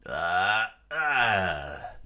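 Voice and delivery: loud drawn-out effort cry; winding-up fighter's yell; attack yell. Acted. Luffy winds up a punch.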